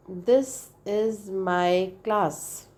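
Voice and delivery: stretched out very slow, syllables drawn apart; slow demo. Shown as a demonstration. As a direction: say it slowly to count the syllables. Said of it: "say the word".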